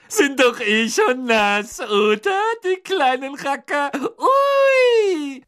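high, drawn-out mischievous squeal; cackling poltergeist. A narrator's reading. Peeves throws water bombs at students, cackling gleefully. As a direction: taunt in a high voice, ending in a loud whoop. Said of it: "gackerte Peeves".

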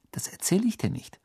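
hushed, whispered refusal. A narrator's reading. Ginny won't tell Ron who it is, whispering. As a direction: keep the secret under your breath.

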